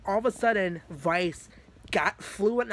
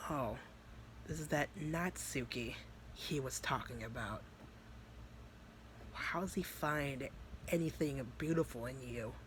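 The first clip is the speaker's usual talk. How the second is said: lightly voiced scornful demon; 2 semitones lower, 11 dB quieter than usual. Demon Vice sizing up Natsuki, quietly scornful. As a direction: softly belittle her, a hint of demon menace.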